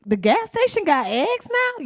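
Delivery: mock disbelief. Podcast host mocking shoppers finding dollar-store eggs, high mock disbelief. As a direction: squeal it like a shocked shopper.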